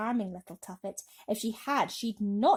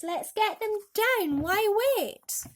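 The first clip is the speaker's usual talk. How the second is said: much higher, loud, slow crowd shout; mighty shout; 12 semitones higher, 6 dB louder, slower than usual. A narrator's reading. The crowd shouting to get them down, high and loud. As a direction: shout it up the building. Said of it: "a mighty shout went up".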